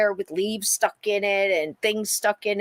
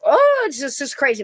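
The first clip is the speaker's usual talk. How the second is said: higher and louder, alarmed; startled exclamation; 6 semitones higher, 6 dB louder than usual. Retold or imitated. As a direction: blurt it out as the screams start. Hearing mother scream in house.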